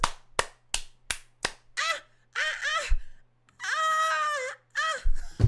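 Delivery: high, loud mock cries of the judge; hamming up her screams; imitated screaming moans. Mocking the judge's screams to the viewers, high and loud. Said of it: "all you heard was".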